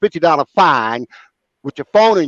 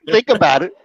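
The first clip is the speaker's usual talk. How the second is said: laughing; 11 semitones higher than usual. Real, not acted. Laughing at the wife's remark.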